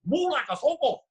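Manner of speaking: high mock Thai-accented English; imitating Thai coach's accent. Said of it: "This is really how he talked".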